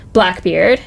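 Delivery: ordinary talk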